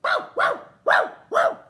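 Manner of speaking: high, loud barking bow wows; loud yelping dog bark. Acted. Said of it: "said Bitzer Maloney".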